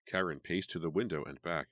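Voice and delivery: plain reading voice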